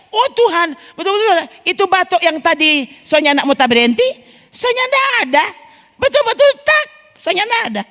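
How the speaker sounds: high, rising outpouring of wonder; amazed thanks. Retold or imitated. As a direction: cry out to God, overwhelmed that the cough is gone. Retold amazed prayer of thanks. (takjub, suara tinggi penuh syukur)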